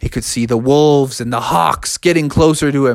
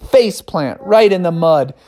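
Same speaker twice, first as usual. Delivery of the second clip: plain reading voice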